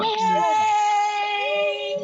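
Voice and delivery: high slow drawn-out Congratulations; excited. Real, not acted. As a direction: sing out the win, slowly. Announcing the winner.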